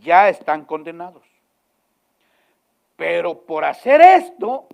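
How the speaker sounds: loud, forceful pulpit delivery; fiery preaching. Acted. Pastor warns the congregation of condemnation, booming. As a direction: thunder it from the pulpit.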